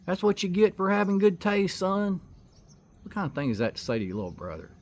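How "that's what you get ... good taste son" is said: high, loud mocking brother's yell; retold yell. Big brother yelling down at the bleeding narrator, high and loud. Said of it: "he's yelling".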